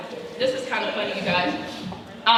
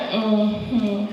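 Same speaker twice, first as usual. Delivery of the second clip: mother's mm-hmm. Retold or imitated. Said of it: "in that mother voice".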